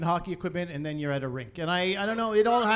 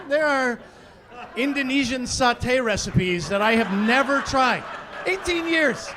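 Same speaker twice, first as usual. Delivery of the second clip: mock rant; 5 semitones higher, 5 dB louder than usual. Mock complaint about allergic daughter.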